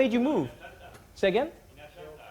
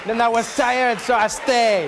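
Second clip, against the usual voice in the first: mock French storyteller's accent; hammed-up French-accented traveller; 3 dB louder, slower than usual. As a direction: wrap up the tale in a French accent. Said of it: "your accent".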